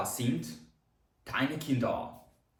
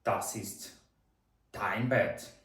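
split model sentence; 3 semitones lower, much slower than usual. Shown as a demonstration. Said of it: "а давайте тепер скажемо".

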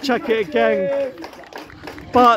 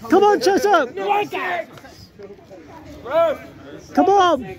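raised, pleading cheer; urging; 5 semitones higher than usual. Real, not acted. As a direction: call on the team. Urging the team on.

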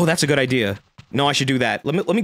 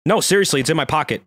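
ordinary delivery

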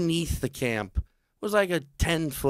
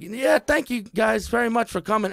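high overly polite; mock polite thanks; 10 semitones higher, 3 dB louder than usual. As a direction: usher them out nicely. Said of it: "it was like".

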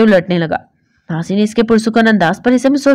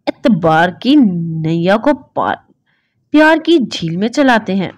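slow, tender recited verse; poem recitation. Acted. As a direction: lay the lines out gently like a love poem. (धीमी, नरम कविता पाठ)

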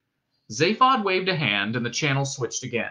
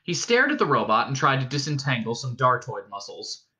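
ordinary delivery

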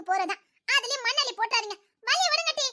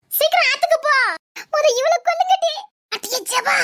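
higher, louder shout; louder, higher exasperated shout; loud yelling; 7 semitones higher, 6 dB louder than usual. Acted. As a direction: yell at the others in a panic. Friends yell at each other.

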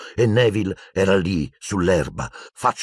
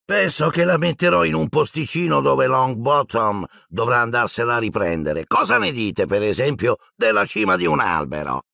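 higher, sly gloating voice; malicious grin; 4 semitones higher than usual. Acted. Malfoy grins maliciously planning to hide the remembrall. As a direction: taunt with a nasty smile. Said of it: "Malfoy sorrise maligno".

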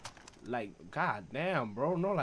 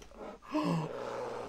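gasp; 5 semitones higher than usual. Real, not acted. Bear suddenly appears.

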